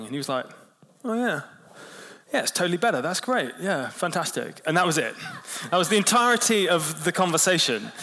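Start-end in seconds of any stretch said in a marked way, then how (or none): 1.0-4.6 higher mock voice of the colleague, hurrying past the topic; brushing-off colleague. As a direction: imitate the embarrassed bloke, pitched up.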